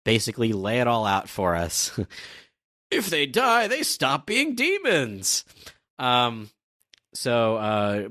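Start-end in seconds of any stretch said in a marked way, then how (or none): 2.9-5.4 high, drawn-out theatrical cry; proclaimed revelation. Acted. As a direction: announce the discovery high and slow. Cries out the story's revelation.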